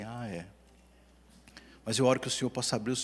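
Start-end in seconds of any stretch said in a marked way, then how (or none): 0.0-0.5 apathetic shrug. Retold or imitated. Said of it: "ele tá assim".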